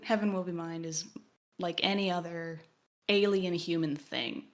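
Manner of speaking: usual speaking voice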